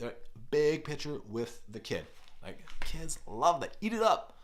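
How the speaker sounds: ordinary talk, nothing marked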